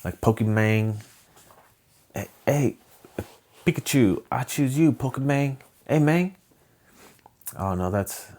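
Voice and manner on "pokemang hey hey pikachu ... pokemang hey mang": hammed-up East LA "mayng"; East LA accent. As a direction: put on a playful East LA accent. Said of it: "like, East L A".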